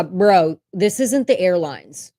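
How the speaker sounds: normal talking voice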